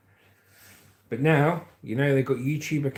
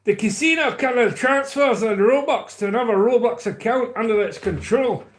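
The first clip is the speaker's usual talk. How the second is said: higher-pitched, hammed-up Scottish reading; put-on Scottish accent; 6 semitones higher, 4 dB louder than usual. Shown as a demonstration. Streamer on a roll reading for viewers, mock Scottish accent. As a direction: read it out in a lilting mock Scottish. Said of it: "do the next paragraph in Scottish".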